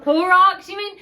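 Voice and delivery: shouting "Clorox" way up high; mock outraged correction; loud high exclamation. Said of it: "And I'm like".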